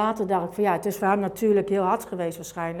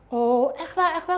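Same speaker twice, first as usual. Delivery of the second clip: higher, eager delighted disbelief; thrilled; 8 semitones higher, 3 dB louder than usual. Retold or imitated. Mother hearing she'll visit her sons, thrilled higher voice. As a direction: squeal it with delight.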